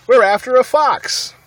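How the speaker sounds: loud high reply; shouting back. Acted. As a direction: shout it up to the crowd. Farmer calls back up.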